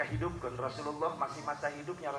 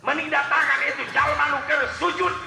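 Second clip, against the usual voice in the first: loud, high-pitched sermon cry to the congregation; fiery preaching; 10 semitones higher, 12 dB louder than usual. Acted. Preacher acting out the prophets' replies, loud preaching. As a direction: preach it at full force.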